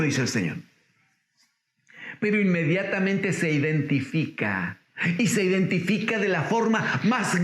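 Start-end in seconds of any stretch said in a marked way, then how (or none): none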